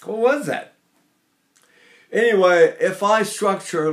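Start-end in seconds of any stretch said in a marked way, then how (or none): none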